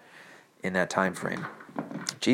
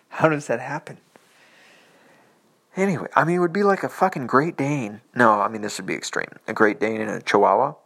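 incredulous; 3 semitones higher, 8 dB louder than usual. Real, not acted. Laughing incredulous rant, louder.

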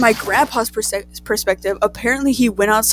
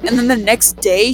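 usual speaking voice

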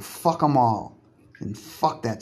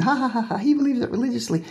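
mocking fake laugh, pitched far up and quicker; 10 semitones higher, faster than usual. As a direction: sneer it out through a put-on chuckle. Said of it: "I know people say".

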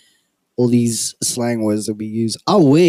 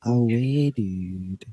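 a lightly goofy, fading drawl that goes softer; 7 dB quieter, much slower than usual. Pretend. Friend shows how he says aware when feeling goofy, mock goofy drawl. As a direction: hams it up to act silly, trailing off quietly. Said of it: "If I'm feeling a little, you know, goofy".

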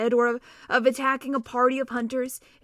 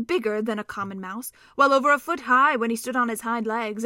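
ordinary delivery

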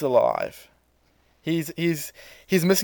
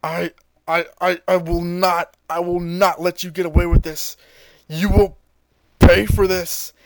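through clenched teeth; 3 semitones higher, 6 dB louder than usual. Retold or imitated. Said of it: "he says through clenched teeth".

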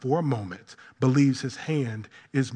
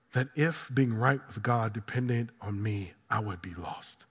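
low, hushed, reflective delivery; quiet confession; 6 semitones lower, 9 dB quieter than usual. Real, not acted. Preacher confessing his need for grace, quiet and humble. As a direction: bring it right down, soft and humble.

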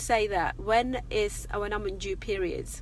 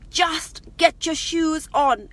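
louder, higher and slower, losing patience; exasperated mum raising her voice at a dawdling son; impatient raised voice; 6 semitones higher, 7 dB louder, slower than usual. Retold or imitated. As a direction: snap the request out louder and slow. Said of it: "it went to this".

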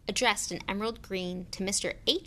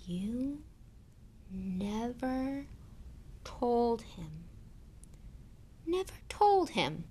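slow, hushed, halting fury, higher pitch; trembling with rage; 4 semitones higher, 3 dB quieter, much slower than usual. A narrator's reading. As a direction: force out each word in held-back rage. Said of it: "every syllable trembled with rage".

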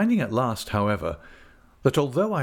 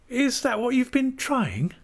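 put-on very high, exclaiming child-wife voice; Dora crying out. A narrator's reading. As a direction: exclaim in a girlish high pitch. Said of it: "cried Dora".

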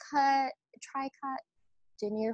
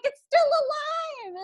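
high, slow alarmed mom voice; mom's alarm; slower than usual. Retold or imitated. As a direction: squeak it out like a startled mother. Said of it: "she was like".

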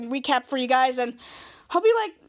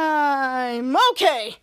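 long held cry sliding into "I'm okay"; drawn-out acted wail ending in reassurance; screaming into words; much slower than usual. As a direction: stretch a mock scream out, then resolve it into words. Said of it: "Mary and Trent heard the screaming".

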